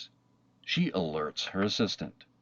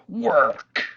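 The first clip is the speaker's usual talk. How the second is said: sudden louder, higher cry on work; frustrated outburst; 10 semitones higher, 7 dB louder than usual. A narrator's reading. Narrator voicing the girl losing patience, a sharp frustrated cry. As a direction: let it burst out, higher and louder.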